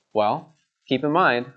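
ordinary talk

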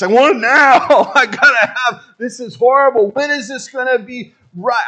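high, loud whiny demand with a laugh; loud, laughing mock tantrum; impatient outburst. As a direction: throw a childish tantrum at God. Acting impatient demand to God.

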